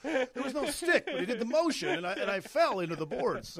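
mimicking a player's fake protest; mock outraged diver. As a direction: play the wronged victim. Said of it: "he, he".

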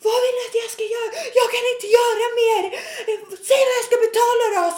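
high, loud hysterical wail; angry, near tears. Retold or imitated. Customer stopped by manager, tearful furious screaming. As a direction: imitate a customer screaming on the edge of crying. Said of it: "nästan gråta".

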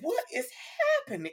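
squeaky high incredulous question. Real, not acted. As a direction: shoot way up in disbelief. Stunned reaction to surprise.

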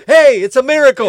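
loud, high, hammed-up amazed exclamation; mock miracle cry. Mocking the fake fluent star, loud and high. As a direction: play the fake overnight Spanish speaker proudly.

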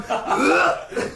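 high gagging noise through laughter; retching gag. Retold or imitated. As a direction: gag like the smell hit him. Plumber gagging again.

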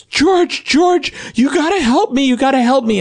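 imitating a panicked, crying mother; very high, pleading mock distress; frantic mom. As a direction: plead for help in a hammed-up high voice. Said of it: "frantic. She's crying".

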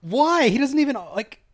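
high, disbelieving cry of why; incredulous outburst. Real, not acted. Friend protests the pointless Kingsman link in disbelief, high incredulous voice. Friend hears baffling sequel news.

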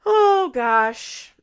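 dismayed groan. Real, not acted. Host reacting to the fat remark, dismayed.

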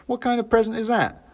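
put-on high indignant kid; petulant teen scoff; sulky boy. A narrator's reading. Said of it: "he said".

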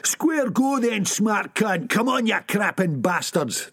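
ऊँचे, धीमे सुर में ललकार; लड़ने को ललकारना; shouting challenge. Acted. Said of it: "the shell suit shouted".

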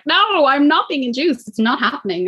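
high, drawn-out protest, retold; emphatic refusal. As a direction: dig in with a long, high-pitched no. Retold refusal of induction to midwives.

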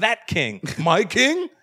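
high incredulous questions. Acted. Interrogator learning of the king, incredulous.

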